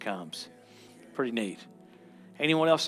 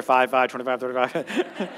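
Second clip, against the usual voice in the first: auctioneer; 3 semitones lower than usual. Pretend. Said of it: "It's like an auctioneer".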